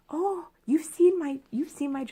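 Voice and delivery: high, softening starstruck murmur; acted starstruck. Vlogger playing smart like her film heroine, acted starstruck.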